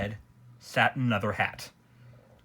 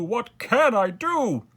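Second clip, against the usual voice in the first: louder, higher, stretched wail with stress on CAN; despairing wail; 12 semitones higher, 8 dB louder, slower than usual. A narrator's reading. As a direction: wail it out, leaning hard on "can". King despairs, repeating the question.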